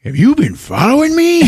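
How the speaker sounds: very high, incredulous demand; mock outraged accusation; angry confrontation. Stone grabbing Durkin by the shirt, mock outrage.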